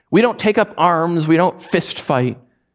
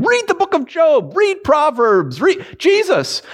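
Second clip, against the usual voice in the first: high, drawn-out disbelief; incredulous; 13 semitones higher than usual. Real, not acted. Preacher amazed anyone calls sarcasm wrong, high and incredulous. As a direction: pitch it way up in amazement. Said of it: "incredulous listing".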